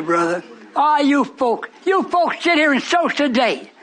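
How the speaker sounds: ordinary talk, nothing marked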